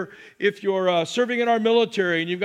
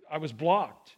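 low-key, lower-pitched mock dejection; mock hurt; 4 semitones lower, 4 dB quieter, slower than usual. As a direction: let it drop, playing the rejected one. Retelling being blocked, acted hurt.

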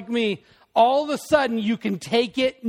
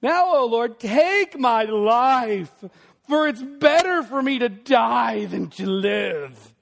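a slow, louder, hammed-up plea to die; melodramatic lament; 2 semitones higher, 4 dB louder, slower than usual. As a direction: overact Jonah begging God for death. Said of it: "get ready for some melodrama here".

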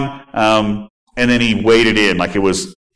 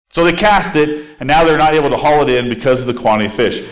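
ordinary talk, nothing marked

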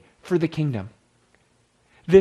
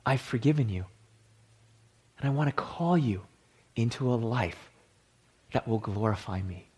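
guided meditation; 3 semitones lower than usual. Acted. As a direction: guide the listeners inward, hushed. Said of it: "lead us in a bit of an exercise".

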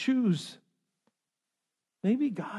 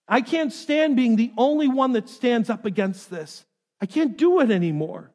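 loud, raised-pitch plea of a man at his limit; despairing complaint; 5 semitones higher, 7 dB louder than usual. Acted. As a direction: cry out as the last one left, desperate and forceful. Elijah's despairing complaint to God, acted.

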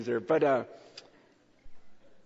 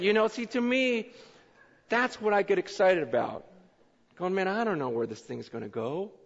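normal talking voice

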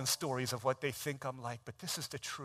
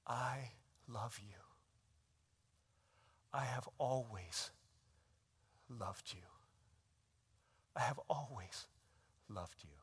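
barely voiced, slow and tender, fading to nothing; mouthed whisper; 10 dB quieter than usual. Father mouths to his daughter, soft whisper. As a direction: breathe it as if only she may hear. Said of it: "he mouths these words".

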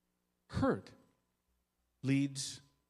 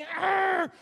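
loud high frustrated roar; angry growl; 6 dB louder than usual. Retold or imitated. Father ripping into his scared son, angry roar. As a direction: let out a furious arr. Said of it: "I'm saying".

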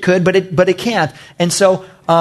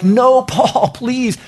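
higher-pitched mock panicked plea; alarmed pleading; 5 semitones higher than usual. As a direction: imitate frightened friends begging him to stay. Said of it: "and they're like".

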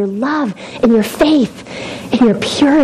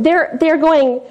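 usual speaking voice

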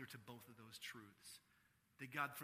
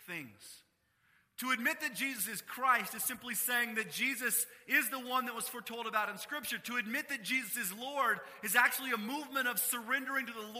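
louder, higher, hammering each my; preaching at full force; 8 semitones higher, 12 dB louder than usual. Acted. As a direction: press every word on the room.

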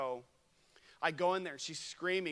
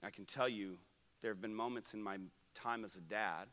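usual speaking voice

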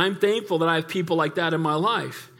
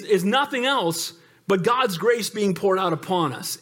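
usual speaking voice

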